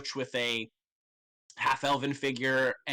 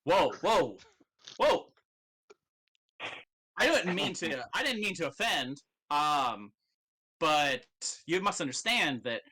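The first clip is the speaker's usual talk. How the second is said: flustered backing off; 3 semitones higher than usual. Acted. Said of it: "He's like".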